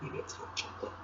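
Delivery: breathy whispered guess. Real, not acted. Reader whispering a guess to the listening children. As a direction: whisper it like a secret. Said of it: "reader guesses in a whisper".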